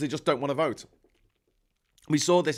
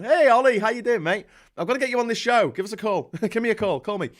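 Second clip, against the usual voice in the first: higher and much louder, cheery shout to a viewer; excited call-out; 9 semitones higher, 7 dB louder, faster than usual. Real, not acted. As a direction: hail a mate across the room, delighted. Calls out to a viewer on stream.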